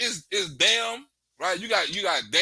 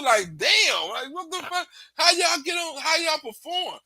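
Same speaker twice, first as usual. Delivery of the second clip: can't believe it, half shouting; incredulous; 8 semitones higher than usual. Retold or imitated. Incredulous reaction, loud high.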